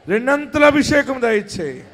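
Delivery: lifted, loud plea for అభిషేకం. Shown as a demonstration. Pastor urging the church to pray loudly, crying out. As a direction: cry it out to the Lord. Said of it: "గట్టిగా ప్రార్థన చేయండి".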